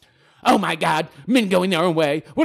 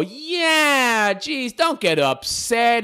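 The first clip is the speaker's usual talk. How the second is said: sarcastic mock soothing; 8 semitones higher, much slower than usual.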